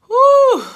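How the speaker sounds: sudden very high, loud whoop of relief; joyful whoop. Real, not acted. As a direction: let out a triumphant woo. Celebrates finally getting it working.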